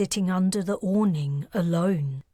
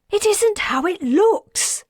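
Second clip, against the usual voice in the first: much higher, frantic woman's voice; panicked plea; 13 semitones higher, 5 dB louder than usual. A narrator's reading. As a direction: plead desperately, caught out. Woman caught cheating pleads.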